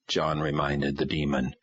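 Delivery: plain reading voice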